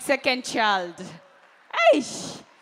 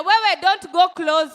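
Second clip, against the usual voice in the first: high loud shout of warning; 7 semitones higher, 11 dB louder than usual. Acted. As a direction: yell to keep them back. Warning women off husband.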